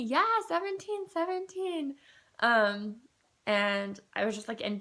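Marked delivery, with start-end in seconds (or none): 0.0-2.0 high, bright-sweet imitation of a nurse confirming; calm her down with the good news; light imitation of a nurse soothing a patient; reassuring nurse. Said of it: "she just kept reassuring me".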